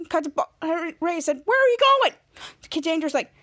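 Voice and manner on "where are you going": high-pitched urgent question; calling out. Retold or imitated. Ray asks departing Henry, high urgent question. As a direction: call the question after them.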